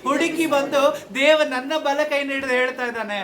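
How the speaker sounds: high-pitched, rhythmic pulpit refrain; fervent preaching. Real, not acted. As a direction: preach it as impassioned question and answer.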